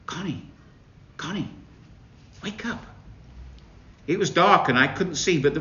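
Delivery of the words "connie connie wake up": soft, hushed calling of a name; whispering. A narrator's reading. Ghostly voice whispering Connie awake. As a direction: whisper to wake her gently. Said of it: "someone whispering my name".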